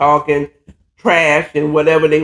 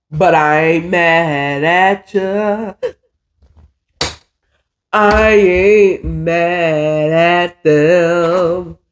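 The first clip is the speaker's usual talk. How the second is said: slow cool shrug; much slower than usual. Retold or imitated. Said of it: "He said".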